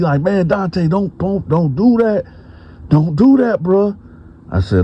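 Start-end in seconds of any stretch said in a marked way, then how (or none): 0.2-4.0 much higher, urgent begging; pleading protest. Retold or imitated. [name] begs Dante to drop it, high and urgent. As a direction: beg him not to go there.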